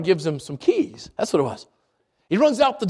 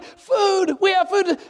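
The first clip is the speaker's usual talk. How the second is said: high, thrilled exclaim; excited; 8 semitones higher, slower than usual. Retold or imitated. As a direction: gasp with joy at breakfast. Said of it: "And he's so excited".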